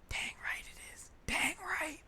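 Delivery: emphatic stage whisper; whispered boast. Retold or imitated. Student answering praise of his Irish name, proud whisper. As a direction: whisper it like a private cheer.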